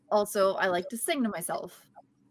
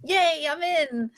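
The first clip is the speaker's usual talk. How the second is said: mild cheer; 7 semitones higher, 3 dB louder, slower than usual. Acted. Cheering at being accepted.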